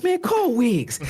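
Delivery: high, insistent imitation of a teammate; re-enacted exasperated demand. Bench veteran wanting Wiggs fetched, high exasperated imitation. Acting Iguodala demanding Wiggins.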